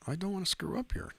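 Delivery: mock high squeaky repeat. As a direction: jump the voice way up, teasing. Repeats line, second one high.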